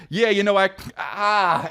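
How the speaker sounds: high-pitched imitated crying onset. Comic re-enacting the end of The Fighter, mock breakdown. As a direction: start talking, then crack into a mock sob. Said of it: "he just starts crying".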